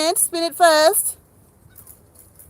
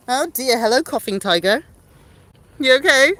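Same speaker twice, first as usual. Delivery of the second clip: ordinary talk, nothing marked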